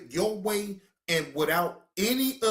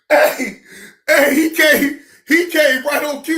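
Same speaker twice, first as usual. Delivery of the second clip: laughing loudly; 8 semitones higher, 9 dB louder than usual. Real, not acted. Laughing about the dog.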